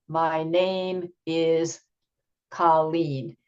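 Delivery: My name is, a pause, then Colleen falling; slow it down and pause before Colleen; pause and stress. Shown as a demonstration. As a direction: demo of a pause in the sentence. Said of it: "you would pause after Colleen, and then you would probably put emphasis".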